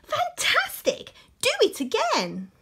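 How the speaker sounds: very high, slow, loud cheer; excited shout. Acted. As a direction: cheer him on at full voice. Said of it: "Elizabeth shouted".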